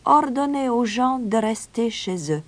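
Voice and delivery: high, composed piper's voice; instructing piper. A narrator's reading. Piper instructs the mayor, high and composed. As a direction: give the order in a high voice.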